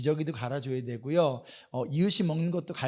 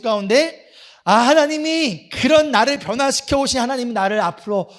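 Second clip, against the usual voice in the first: louder, raised preaching voice; 6 semitones higher, 11 dB louder than usual. Acted. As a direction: build the sermon point with force. Preaching with rising force.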